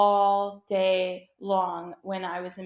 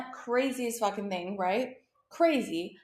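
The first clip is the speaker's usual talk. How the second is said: normal talking voice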